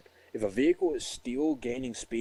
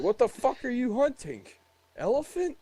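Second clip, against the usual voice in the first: high, scoffing retort, pitched way up; mocking disbelief; 13 semitones higher, 4 dB louder than usual. Soldier ridiculing a suspect's hunting story, high mocking disbelief.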